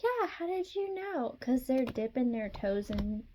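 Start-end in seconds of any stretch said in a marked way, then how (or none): 0.0-1.4 naive surprise. Retold or imitated. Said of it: "And you'll be like".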